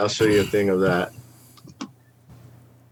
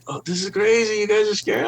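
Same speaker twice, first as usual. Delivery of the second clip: mocking coworkers; 9 semitones higher than usual. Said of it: "they were like".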